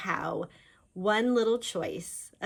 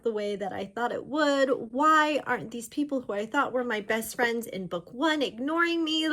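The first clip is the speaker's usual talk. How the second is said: exasperated then suspicious; frustrated heroine; 6 semitones higher, 5 dB louder than usual. Retold or imitated. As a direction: complain like a fed-up girl, trailing off.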